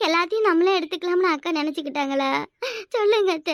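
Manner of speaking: put-on crying reproach; tearful accusation. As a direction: demand an answer, dissolving into sobs. Tearful accusation to the mother-in-law. (அழுகையோடு குற்றம் சாட்டும் குரல்)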